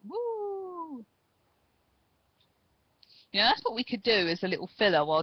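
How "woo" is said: long high falsetto woo; Ric Flair woo. Retold or imitated.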